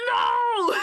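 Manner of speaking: high, loud wail of "no"; hammed-up cry of protest; re-enacted distraught no. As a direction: imitate your own distraught yell, high and loud. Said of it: "I went".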